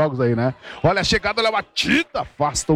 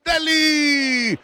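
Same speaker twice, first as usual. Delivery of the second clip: screaming goal call, rising pitch; explosive goal commentary; 9 semitones higher than usual. Real, not acted. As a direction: yell the goal with everything. Live goal call, scoring moment.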